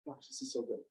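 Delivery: hint at the actor's tiny messenger voice, softly; little voice. Retold or imitated. Recalling Steve Carell reading as the messenger, lightly imitated soft little voice. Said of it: "he did this little voice".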